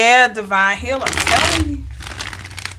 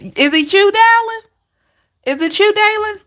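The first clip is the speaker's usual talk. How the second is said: higher, louder questioning call; unsure name call; 12 semitones higher, 4 dB louder than usual. Real, not acted. Reader calls to viewer Dallin, high questioning.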